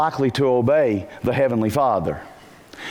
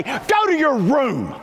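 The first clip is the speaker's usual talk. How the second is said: exasperated order; 9 semitones higher, slower than usual. Retold or imitated. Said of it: "and you say".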